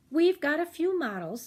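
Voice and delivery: shop clerk. Acted. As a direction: pitch it like a keen young salesman. Teenager voiced again.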